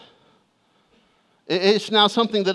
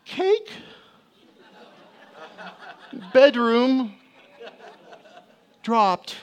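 put-on high child's voice between sobbing breaths; catching breath; 6 semitones higher, much slower than usual. Son explaining to dad after crying, catching breath. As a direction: gasp out one word at a time. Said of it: "he takes a breath".

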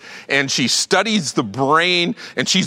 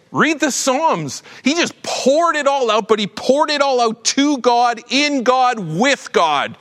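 much higher, slow urging; fervent, rising; 8 semitones higher, slower than usual. Real, not acted. Pastor urging congregation to pour out to God, fervent. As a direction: lift it up with fervor.

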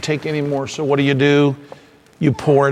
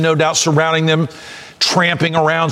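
ordinary delivery

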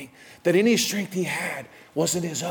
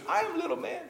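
much higher, tiny, softer voice; little man voice; 13 semitones higher, 8 dB quieter, faster than usual. Retold or imitated. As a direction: squeak it out like a small boy. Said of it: "He goes".

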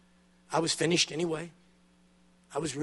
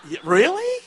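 very high, louder incredulous "really?"; sarcastic disbelief; 13 semitones higher, 5 dB louder than usual. Real, not acted. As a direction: toss it off in mock disbelief. Reacting to misplaced praise.

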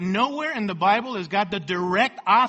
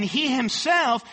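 HIMSELF stressed, slow and raised in pitch; emphatic; 5 semitones higher, 4 dB louder, slower than usual. Shown as a demonstration. Preacher quoting James on God, HIMSELF stressed emphatically. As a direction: lean slowly on HIMSELF, pitched up.

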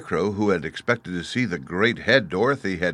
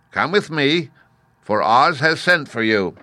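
higher-pitched messenger voice; character voice, raised and official; summoning soldier; 6 semitones higher, 5 dB louder than usual. A narrator's reading. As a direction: deliver the summons like a sentry.

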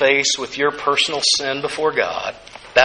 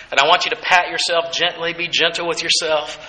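normal talking voice

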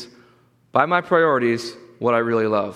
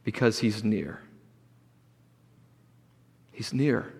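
hushed, softer and slightly lower, with long pauses; still small voice; 6 semitones lower, 7 dB quieter than usual. Shown as a demonstration. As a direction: fade to a quiet, intimate closeness. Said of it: "in a still small voice".

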